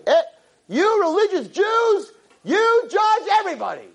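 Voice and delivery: high, slow, shouted accusation; angry shout. Retold or imitated. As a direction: yell it at them.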